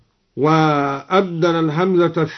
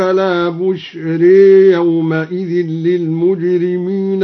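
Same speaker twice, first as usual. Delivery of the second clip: slow melodic tajweed chant; Quran recitation; slower than usual. Shown as a demonstration. Recites the verse as demo. (تلاوة مرتلة بطيئة)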